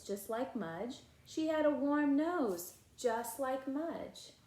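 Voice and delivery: ordinary delivery, nothing marked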